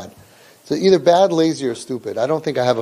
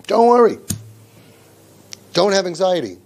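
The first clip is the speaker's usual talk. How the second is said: glib parent; 4 semitones higher than usual. Retold or imitated. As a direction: brush the worry off with a glib reassurance. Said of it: "you say".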